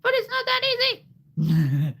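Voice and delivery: tiny, squealing falsetto; extremely high-pitched. Shown as a demonstration. Voice actor shows how high he goes, squeaky-high. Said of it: "make it go even higher".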